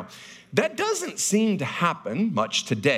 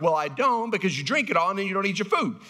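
quick dad reply; 6 semitones higher, faster than usual. Retold or imitated. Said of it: "And I'm like".

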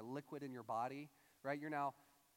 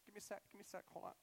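mock choked up; 3 semitones higher, 9 dB quieter, much faster than usual. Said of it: "has these emotions still going through him".

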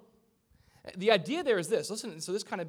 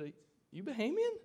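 light Bahamian lilt, pitched up; Bahamian accent; 7 semitones higher than usual. Retold or imitated. Pastor greeting the Bahamian worker in a Bahamian accent. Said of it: "I brought out my Bahamian dialect".

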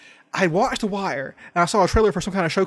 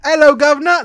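hammed-up Cockney, much higher and louder; mock Cockney; 8 semitones higher, 9 dB louder than usual. Dodging the Nigerian request with a loud mock Cockney. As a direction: bellow a cartoon Cockney greeting. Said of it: "now do Nigerian".

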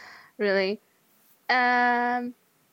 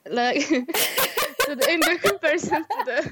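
real laughter breaking up the words, pitched higher; laughing while talking; 7 semitones higher, 4 dB louder than usual. Real, not acted. Co-host cracking up at their own bottle comparison, laughing. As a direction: giggle through the phrase as it climbs.

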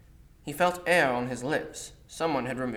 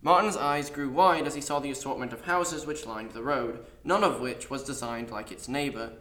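usual speaking voice

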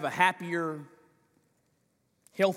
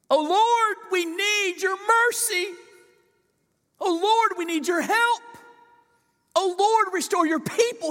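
high, loud, desperate pleading; impassioned cry; 6 dB louder than usual. Shown as a demonstration. Preacher acting out Daniel's prayer as a fervent, loud cry. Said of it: "he's not just praying, he's praying".

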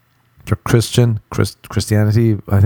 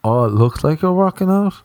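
high, impressed and admiring; upbeat approving tone of a friend; 7 semitones higher than usual. Retold or imitated. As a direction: say it like a real compliment. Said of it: "couldn't tell in the tone".

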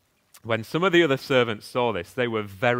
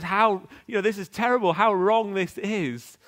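upset and angry; 7 semitones higher, 4 dB louder than usual. Retold or imitated. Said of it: "gets upset and angry and says".

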